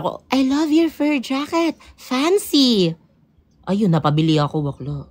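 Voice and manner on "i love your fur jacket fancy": slow, higher mock foreign compliment; foreigner's compliment. Quoting a foreigner praising her jacket, slow and high. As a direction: coo it like an impressed stranger.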